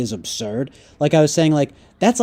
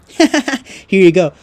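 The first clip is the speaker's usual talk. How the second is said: slow, higher, louder taunting laugh-voice; mock devil; 5 semitones higher, 7 dB louder, much slower than usual. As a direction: draw it out like a gleeful devil. Mocking the devil handing iPad.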